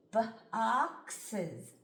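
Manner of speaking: "Boxes" stretched out slowly, sound by sound. Shown as a demonstration. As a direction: drag "Boxes" apart into its sounds. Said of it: "Watch me sound this out".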